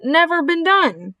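high-pitched official protest; announcer balking at the very idea; objecting loudspeaker announcer. A narrator's reading. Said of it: "said the loudspeaker".

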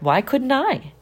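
usual speaking voice

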